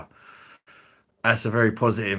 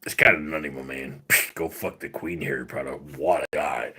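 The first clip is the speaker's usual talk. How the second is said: low put-on voice with catchphrases; Randy; 6 semitones lower than usual. Demonstrating how to do Randy, low-voiced impression. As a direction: keep it low, dropping further on each tag. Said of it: "You have to be like".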